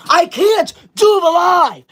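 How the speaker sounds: loud high slow outburst; exasperated shout. Real, not acted. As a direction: cry out in exasperation. Exasperated with gifts.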